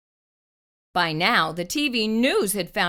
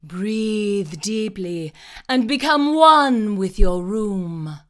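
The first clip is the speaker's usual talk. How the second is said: slow, calm guiding voice; drawn-out therapist calm; soothing therapist; 5 dB louder, slower than usual. A narrator's reading. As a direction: coax her into relaxing. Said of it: "she said".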